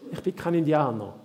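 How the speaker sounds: ordinary delivery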